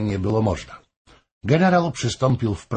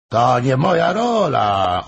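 slow, firm refusal, a little louder; firmly; 4 semitones higher, 4 dB louder, slower than usual. A narrator's reading. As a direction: plant your feet and refuse. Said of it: "odparł stanowczo". (stanowczo, powoli i z naciskiem)

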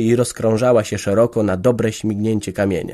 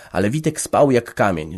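ordinary delivery

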